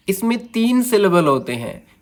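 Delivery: ordinary talk, nothing marked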